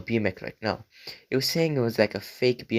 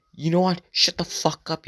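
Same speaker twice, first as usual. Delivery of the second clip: retold yell; 8 semitones higher than usual. Eleven-year-old yelling back at the girl, mock yell. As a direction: throw the insult like an angry kid. Kid finally yells at girl.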